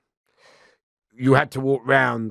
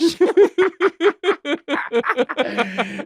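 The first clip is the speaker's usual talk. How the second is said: high cackling laugh; 5 dB louder than usual. Real, not acted. Laughing at the story.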